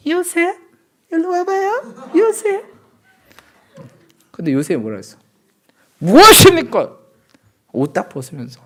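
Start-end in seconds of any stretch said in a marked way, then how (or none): 0.1-2.6 very high, cooing mock-female voice; seductive temptress. Potiphar's wife luring Joseph over, seductive falsetto. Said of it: "요셉이 꼬셔요. 아내가 꼬셔요". 6.0-7.0 high, loud acted shout of refusal; outraged rebuke. Joseph rebuffing Potiphar's wife's seduction, outraged shout. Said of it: "그렇게 죄에 대해서 화를 내야 된다".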